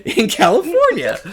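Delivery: high, laughing show of delight; playful hype. Retold or imitated. Teacher hails his California homie, playfully hyped.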